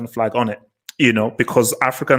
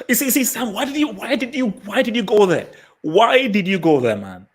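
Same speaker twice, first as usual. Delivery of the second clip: much higher, whiny playful protest; host teasing Sam, higher exasperated whine; mock exasperation; 9 semitones higher than usual. Real, not acted. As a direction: groan at a friend for opening a can of worms. Mock despair at Sam's topic.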